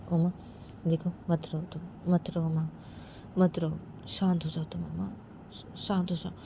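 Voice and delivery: calm her down tenderly; soothing comfort. Acted. Mother soothes crying Uma.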